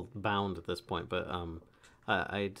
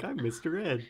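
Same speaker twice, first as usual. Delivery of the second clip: horse voice; 8 semitones higher than usual. Retold or imitated. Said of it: "She's over there like".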